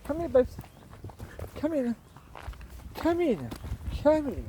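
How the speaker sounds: fond dog call; coaxing the dog. Real, not acted. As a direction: call her in kindly. Calling dog sweetly.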